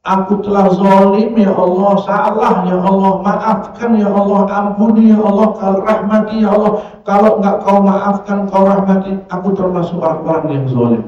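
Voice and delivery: high, insistent wailing prayer; impassioned mock-tearful supplication; acted repentant pleading. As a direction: beg God urgently, phrase after phrase. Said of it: "langsung nunduk sama Allah".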